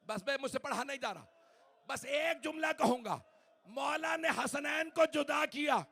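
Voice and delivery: mourning cry over the bier; full-force wailing elegy. Acted. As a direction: wail the lament at full force.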